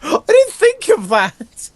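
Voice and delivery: ordinary delivery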